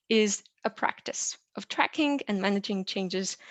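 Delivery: ordinary talk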